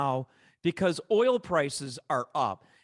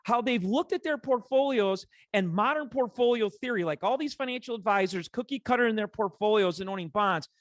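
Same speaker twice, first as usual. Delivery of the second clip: soapbox rant; 8 semitones higher than usual. Real, not acted. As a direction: climb on the soapbox and vent. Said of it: "I'm on a soapbox today".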